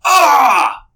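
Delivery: sudden high, loud pained cry; executioner's scream. Acted. Executioner stabbed in the foot, crying out high and loud. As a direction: yelp as a knife hits the foot. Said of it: "all of a sudden you hear".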